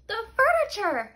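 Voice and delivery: loud, high horrified cry; cry of dismay. Acted. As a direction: wail it in shock. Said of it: "she cried".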